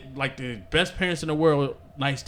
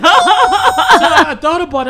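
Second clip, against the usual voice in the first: laughing; 10 semitones higher, 11 dB louder, much slower than usual. Real, not acted. Laughing while telling the story.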